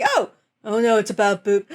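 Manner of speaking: imitated dawning dismay, cut short; mock dismay. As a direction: play her dropping the line, oh-no style. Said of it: "because she's like".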